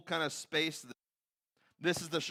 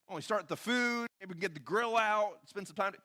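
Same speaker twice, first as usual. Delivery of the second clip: higher-pitched, chipper mock Peter; Peter's eager suggestion; 6 semitones higher than usual. Disciple pitching tents and food to Jesus, naively eager. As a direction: pitch it up, offering helpful plans. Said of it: "he hears Peter's comment".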